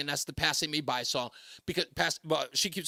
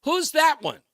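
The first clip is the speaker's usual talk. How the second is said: wife's eager question; 10 semitones higher, 7 dB louder than usual. Retold or imitated. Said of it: "and she goes".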